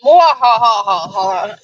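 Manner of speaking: slow booming villain laugh; evil cackle. Pretend. Villain laugh as cookie bandit.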